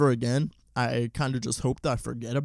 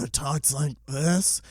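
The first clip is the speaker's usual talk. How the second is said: strained, held-in voice like straining on the toilet; mocking a strained put-on voice; Adam Sandler's movie voice; 2 semitones higher, slower than usual. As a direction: squeeze the words out as if holding something in. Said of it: "his voice that he's doing".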